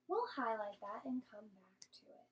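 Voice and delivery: soft whispered aside. Real, not acted. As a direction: whisper it to the class. Aside to class.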